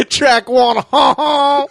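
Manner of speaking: loud, high mock laugh bit; hammed-up comedy album parody; mock album track. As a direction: belt out the pretend track, laughing. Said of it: "back of the C D".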